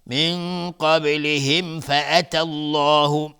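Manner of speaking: فأتى الله with a heavy full الله, slow; slow recitation with clear mim before فا. Shown as a demonstration. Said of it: "میم ساکن بهش فا اظهار شفوی". (اظهار شفوی در قبلهم فأتى)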